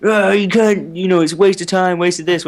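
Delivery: nagging parents. Retold or imitated. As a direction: imitate parents complaining, easing down as it goes. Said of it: "parents being like".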